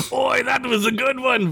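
high hearty dwarf shout; shouting upward. Acted. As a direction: praise the belch up the shaft. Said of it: "Vec shouted upward".

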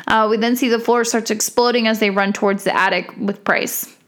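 usual speaking voice

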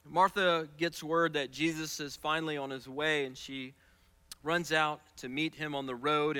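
ordinary delivery

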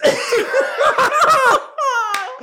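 loud, high laughing fit; real uncontrollable laugh; helpless laughter. Real, not acted. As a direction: crack up completely. Cracks up trying to perform.

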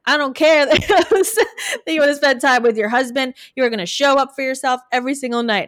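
loud, high, hammed-up tough-love accent; mock-scold a client in a put-on accent; demanding coach with accent. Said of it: "all of a sudden I have an accent".

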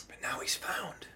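soft, breath-only whisper; tender whisper. Acted. Father explaining to the older brother, tender whisper. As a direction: drop to a hushed whisper.

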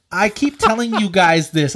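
ordinary talk

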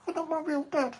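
squeaky high dog voice; high cartoon pet voice; dog's voice. Voicing the dog.

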